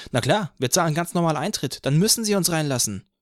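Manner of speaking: another voice from crowd. A narrator's reading. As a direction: toss it off as a cheeky fan. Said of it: "jemand anders sagte".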